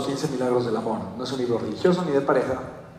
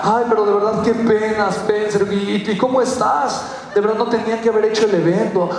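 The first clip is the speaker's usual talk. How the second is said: mock sympathy; 8 semitones higher, 4 dB louder than usual. Maxwell apologizing, sympathetic.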